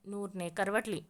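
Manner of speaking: ordinary delivery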